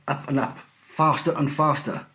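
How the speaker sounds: usual speaking voice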